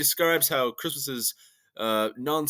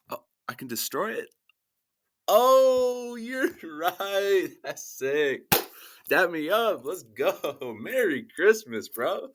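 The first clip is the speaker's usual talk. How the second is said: acted gift skit; 4 semitones higher than usual.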